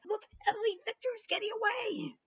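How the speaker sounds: very high squeaky spider voice; tattling spider. Retold or imitated. Spider telling Emily that Victor is escaping, squeaky and high. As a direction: tattle like a tiny creature. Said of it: "the spider is like".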